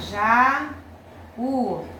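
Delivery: long JÁ, a pause, then U on its own; syllables separated. Shown as a demonstration. Teacher gives pupils another hiatus, já and u said separately. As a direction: break jaú into já and u.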